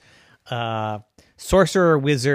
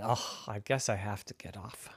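much softer, lower and resigned; sheepish giving in; 6 semitones lower, 15 dB quieter than usual. Acted. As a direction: mumble it, giving up. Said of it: "Wanda said".

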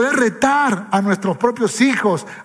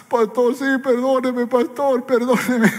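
higher, pleading mock sob; husband crying; 6 semitones higher than usual. Tough husband begs the pastor's pardon, mildly tearful. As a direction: lightly act a broken man begging forgiveness. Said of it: "comenzó a llorar".

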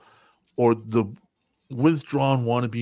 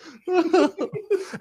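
high laugh. Real, not acted. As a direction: crack up.

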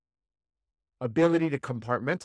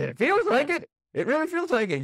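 much higher and louder, alarmed; panicky emotions; 5 dB louder than usual. Retold or imitated. Emotions answering the calm mind, high and alarmed. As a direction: let your panicking feelings squeal. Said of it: "your emotions say".

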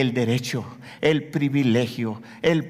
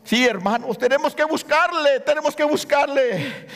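preaching at full force; 9 semitones higher than usual. Acted. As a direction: plead with the congregation, voice raised high. Fervent exhortation and prayer. (predicación ferviente a todo pulmón)

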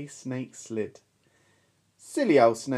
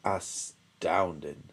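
low, very slow, soft amazement; awed Gruffalo; 9 semitones lower, 6 dB quieter, much slower than usual. Acted. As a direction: draw it out in wonder. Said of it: "said the Gruffalo".

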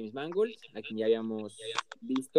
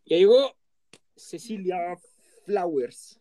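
excited; 8 semitones higher, 6 dB louder, slower than usual. Real, not acted. Calling out arrival.

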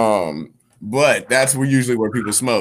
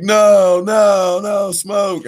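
mock crowd shout, higher and louder; crowd yelling; 10 semitones higher, 6 dB louder, much slower than usual. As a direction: shout it like a hyped audience. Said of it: "people were like".

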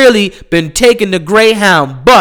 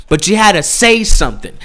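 ordinary talk

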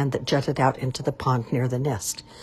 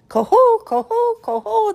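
high loud honking coho call; trumpeting swan call; 7 dB louder than usual. A narrator's reading. Swans calling out in delight, high loud trumpet call. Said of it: "they cried".

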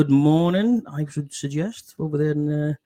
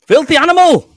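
much higher and louder, calling the guest's name out; loud welcome shout; 13 dB louder than usual. Real, not acted. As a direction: belt out a big welcome to the guest. Host welcomes guest onto stream.

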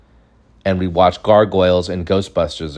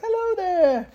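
very high, softer hello; genie-like grandpa; 5 dB quieter, slower than usual. Retold or imitated. Grandpa hugging a kid hello, high soft genie voice. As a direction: imitate a fairy-like greeting, high and light. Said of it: "like, a fairy or, like, like the genie".

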